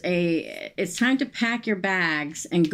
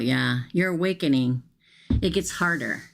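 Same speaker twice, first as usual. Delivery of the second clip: ordinary delivery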